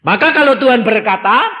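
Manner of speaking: loud, high, fervent sermon voice; preacher driving his point to the congregation; full-force preaching. Acted. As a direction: preach it out, pushing hard.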